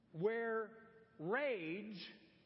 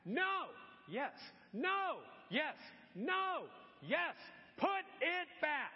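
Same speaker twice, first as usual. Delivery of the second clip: escalating shouting match; 6 semitones higher, slower than usual. Retold or imitated. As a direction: yell no and yes, rising each time. Mom and toddler arguing.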